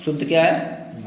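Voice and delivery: usual speaking voice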